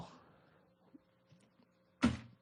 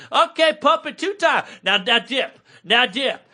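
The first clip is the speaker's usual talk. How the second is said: spin instructor; 7 semitones higher than usual. Retold or imitated. Spin teacher shouting moves at the class. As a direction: pump up the class like a spin coach. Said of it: "there's this house trance music".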